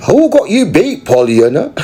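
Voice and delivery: high, loud jeering call; loud high taunt; taunting shout. Acted. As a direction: shout it across the table to needle her.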